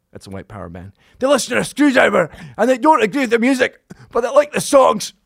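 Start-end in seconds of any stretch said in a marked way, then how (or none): 1.2-5.1 loud, high-pitched Scottish shout; Scottish punk singer. Retold or imitated. Singer dedicating a song from the stage, shouted Scottish accent. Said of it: "'cause of his accent".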